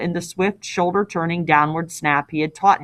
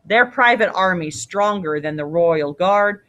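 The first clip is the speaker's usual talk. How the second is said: usual speaking voice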